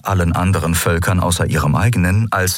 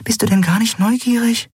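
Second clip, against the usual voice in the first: high, urgent inner plea; silent pleading; 13 semitones higher than usual. A narrator's reading. Hidden Nelen willing the pirate woman to leave, silent pleading. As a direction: coax her on, pitch climbing. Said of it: "flehte Nelen stumm".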